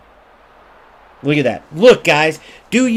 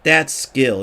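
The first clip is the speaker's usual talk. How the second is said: mocking pro player; 7 semitones lower, slower than usual. Streamer imitating pros approving the play, low and smug. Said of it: "they're gonna look at this and go".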